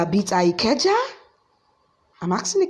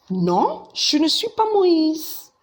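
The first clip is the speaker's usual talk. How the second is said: emphatic denial; 8 semitones higher than usual. Shown as a demonstration. Confuses you for somebody else.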